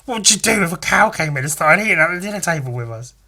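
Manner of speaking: mocking friend. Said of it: "when asked that question".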